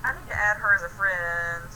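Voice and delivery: usual speaking voice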